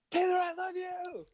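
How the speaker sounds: high, drawn-out fan call; fan shout. Retold or imitated. Calling out to the drummer walking to the stage, fan shout. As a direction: call out to him as he walks by.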